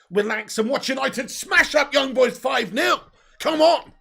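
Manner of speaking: building into a loud, high, slow roar; excited shout. Real, not acted. Fan hyping up the stream for a big win, shouting. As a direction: shout the scoreline like a goal call.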